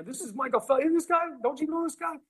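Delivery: very high, incredulous mock voice; excited stranger. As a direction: imitate a starstruck guy convincing his coworkers. Acts out stranger showing off his find.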